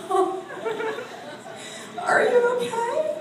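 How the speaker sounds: very high, slow mock-worried voice; hammed-up squeaky worry for a shaking seatmate; retold alarmed concern.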